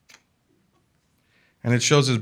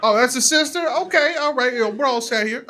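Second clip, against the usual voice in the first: high-pitched, hurried backpedal; smooth it over nervously; imitating a flustered preacher; mock placating pastor; 11 semitones higher than usual. Pastor recovering after learning of sister.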